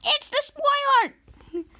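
slow, high, drawn-out alarm; dramatic announcement. Retold or imitated. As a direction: unveil the villain slowly. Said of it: "audience alarm".

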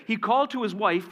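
normal talking voice

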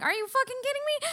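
high, fast disbelief; incredulous. Retold or imitated. To her boyfriend wanting to share the seat, fast high disbelief. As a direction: fire it back in shocked disbelief.

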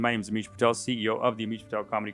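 loud comic CEO sales pitch; showy promo outro. Acted. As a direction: pitch it loudly to your darling viewers. Performs loud hammed-up channel outro.

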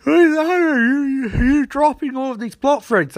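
hammed-up outrage at dropped plots; mock angry yell. Angry hero yelling at Trickster.

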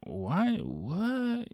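mock bewildered why and what; baffled. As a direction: react in total confusion. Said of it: "I was like".

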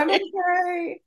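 high, slow, strained I'm okay; mock brave squeak. Claiming composure after crying.

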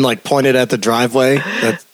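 ordinary talk, nothing marked

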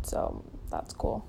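deadpan, breathy aside; sarcastic throwaway under the breath; dry sarcasm. Real, not acted. Sarcasm about torture.

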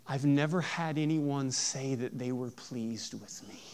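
barely voiced, slow, as if the words hurt; hushed whisper. Pastor voicing Merrick to his congregation, whispered slowly. Retelling Merrick's line in the film.

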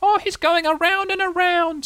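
very high, drawn-out wonder; very impressed. A narrator's reading. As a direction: marvel slowly in a tiny squeal. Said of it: "said Roo, very impressed".

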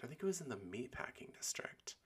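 whispered to herself; whispering. Real, not acted. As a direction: whisper it like a private aside. Answers herself under her breath.

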